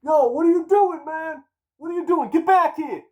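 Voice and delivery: high, loud yell across the lot; yelling after him. Retold or imitated. Plug Nico shouting after him to come back, loud high yell. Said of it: "I hear Nico behind me".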